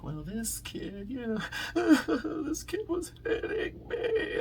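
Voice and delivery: high, whimpering mock sob of a little kid; crying child. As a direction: wail it like a crying kid, hammed-up. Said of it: "his child was crying".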